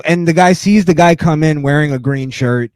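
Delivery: normal talking voice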